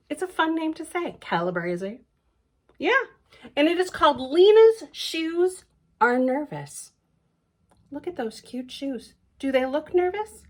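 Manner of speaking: plain reading voice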